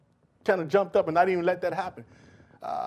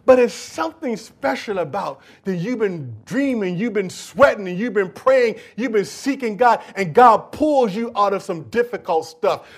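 ordinary delivery

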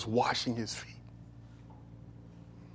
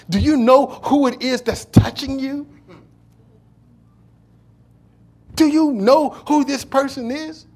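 self-righteous Pharisee, growing louder and higher; the Pharisee scolding Jesus, louder and higher; indignant Pharisee; 6 semitones higher, 7 dB louder than usual. Retold or imitated. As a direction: demand it, rising in outrage. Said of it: "He says".

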